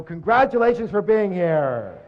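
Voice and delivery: slow, high and loud cheer; cheery congratulations. Shown as a demonstration. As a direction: congratulate your neighbour warmly and loudly. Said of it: "and go".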